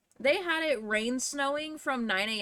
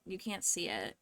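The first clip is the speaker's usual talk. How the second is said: normal talking voice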